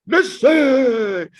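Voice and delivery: loud, high, drawn-out yell; holler. Retold or imitated. Speaker to kids not listening in class, hollering. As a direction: holler it into a microphone to startle them. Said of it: "I would holler in the microphone".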